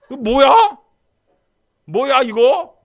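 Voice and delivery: high, loud complaint; indignant outcry. Retold or imitated. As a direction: cry out at being left out. Frustrated at retreat. (수련회에서 혼자 서운해 외치는 목소리)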